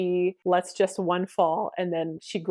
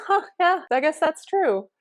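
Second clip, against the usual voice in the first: high, louder amused realisation; amused concession; 9 semitones higher, 5 dB louder than usual. Retold or imitated. Student giving in to teacher's point, amused. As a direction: concede it with a high, surprised lilt. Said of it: "She laughs at me".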